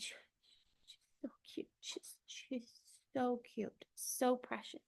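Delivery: soft, hushed, welling up with tenderness; overcome, voice nearly gone; real tearful near-whisper; choked up, near tears. Real, not acted. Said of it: "I'm gonna cry".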